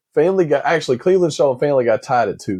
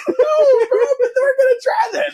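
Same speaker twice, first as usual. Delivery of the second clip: much higher, laughing so hard it cracks; crying laughter. Real, not acted. Cohost losing it over the Ted scene, crying laughter. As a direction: wheeze out the words through laughter.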